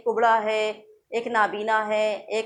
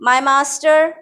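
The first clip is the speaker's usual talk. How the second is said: slow, loud reading of the barber's cry; 7 dB louder, much slower than usual. Acted. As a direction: read the cry out firmly, lightly acted. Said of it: "cried the barber".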